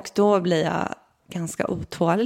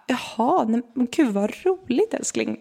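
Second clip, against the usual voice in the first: higher, lightly voiced sleepy husband; 4 semitones higher than usual. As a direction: imitate the just-woken husband's happy reply. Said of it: "helt nyvaken ... bara så här".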